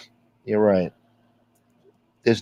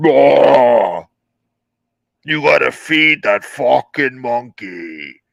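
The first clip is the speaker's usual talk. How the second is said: slow, louder mock yell of the rival; imitating his yelling; 2 semitones higher, 6 dB louder, much slower than usual. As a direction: bellow it like him, slowly. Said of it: "Especially when he talks like this".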